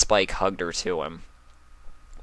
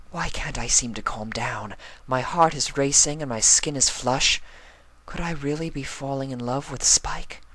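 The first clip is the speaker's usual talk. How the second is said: lightly voiced lady, a bit higher, flustered and unsure; flustered Rarity; 7 semitones higher than usual. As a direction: wonder aloud, heart racing. Rarity voiced, flustered inner thoughts.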